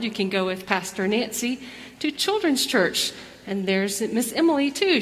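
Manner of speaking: ordinary talk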